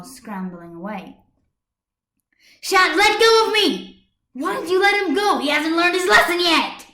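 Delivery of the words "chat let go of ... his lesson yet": much higher, loud furious protest; shrieking at Chat to let go; furious struggling. Acted. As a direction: fight against his grip, yelling. Ladybug struggling in Chat's arms.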